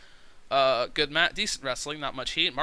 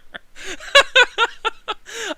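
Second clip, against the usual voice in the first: loud, high burst of real laughter; big laugh; 11 dB louder than usual. Real, not acted. Laughing hard at a viewer comment, loud burst.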